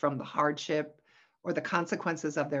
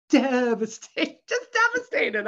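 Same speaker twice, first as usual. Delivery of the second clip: dramatised devastation; 7 semitones higher, 8 dB louder than usual. Retold or imitated. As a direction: play up the devastation, half laughing. Recalling shock at son's arrest.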